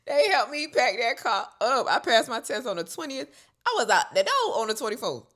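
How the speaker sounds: loud, high, laughing emphasis; amused exclaiming. Real, not acted. Joking how fast family sent her off, loud and amused. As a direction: exclaim it, cracking up.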